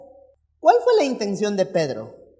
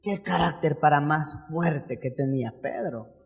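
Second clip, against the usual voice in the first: low, soft marveling remark; quiet aside as a gossiping onlooker; hushed awe; 9 semitones lower, 9 dB quieter than usual. Acted. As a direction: say it under the voice, impressed. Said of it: "o cualquiera diría".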